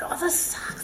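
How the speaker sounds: breathy unvoiced whisper of frustration; whispered complaint. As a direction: mutter it like a private gripe. Inner voice in the middle of struggle.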